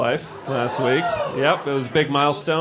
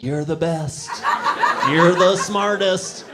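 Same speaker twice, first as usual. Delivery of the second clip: doting parent praise; 4 semitones higher, slower than usual. Retold or imitated. Praise parents give toddlers.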